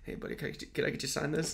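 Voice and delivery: hushed whisper asking for a signature; whispered request. Retold or imitated. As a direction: lean in and whisper the ask. Asking director to sign quietly.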